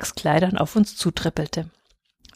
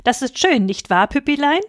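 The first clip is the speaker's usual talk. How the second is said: high-pitched cooing question to a pet; cheerful; 6 semitones higher than usual. A narrator's reading. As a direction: chirp happily to your little dog. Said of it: "rief sie fröhlich". (fröhlich und verzückt)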